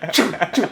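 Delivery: two loud, high imitated swishes; whooshing shot noises. Coach imitating the kid drilling balls, explosive whooshes. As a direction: mouth rapid club whooshes, excitedly. Imitates repeated ball strikes.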